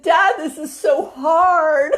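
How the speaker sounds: high, slow tearful whine; imitating a little boy in tears; mock crying. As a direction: whimper it like a crying child. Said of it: "started crying".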